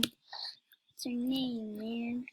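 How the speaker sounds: unvoiced slow whisper. Shown as a demonstration. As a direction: breathe the question out slowly. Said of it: "I'm just".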